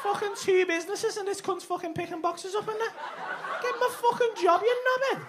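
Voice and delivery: high, laughing mockery; giggling jeers at a mate; laughing insults. Real, not acted. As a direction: laugh through the taunts. Mocking mate, laughing loudly.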